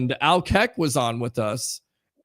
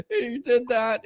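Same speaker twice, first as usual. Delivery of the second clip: ordinary talk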